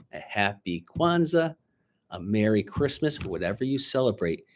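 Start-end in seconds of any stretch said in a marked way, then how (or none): none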